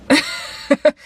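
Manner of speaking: breathy giggle; unvoiced laugh. Real, not acted. Funny name story.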